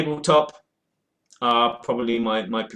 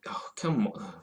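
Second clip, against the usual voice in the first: whispered aside; 9 dB quieter than usual. Real, not acted. Reacting to phone message.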